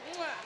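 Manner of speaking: a playful kiss sound; blown kiss. Real, not acted. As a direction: blow the crowd a kiss. Host blowing kisses to audience.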